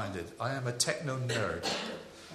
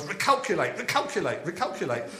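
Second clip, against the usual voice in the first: higher and louder robotic sat-nav voice, fading on the third; 4 semitones higher, 3 dB louder than usual. Retold or imitated. Preacher mimicking his sat-nav rerouting again and again, robotic. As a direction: nag like a stubborn sat-nav. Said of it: "this sort of noise".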